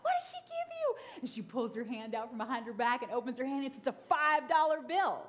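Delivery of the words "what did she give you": mock excited mom. Mom's fake excitement.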